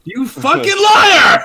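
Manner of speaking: half-joking furious yell; mock outraged shout. Real, not acted. Accusing friend of lying, joking.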